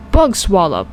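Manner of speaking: usual speaking voice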